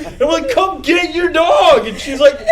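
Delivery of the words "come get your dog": slow, high, loud retold shout; acted-out yell, each word drawn out; screaming back. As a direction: holler it to the dog's owner. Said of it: "We're just screaming at each other".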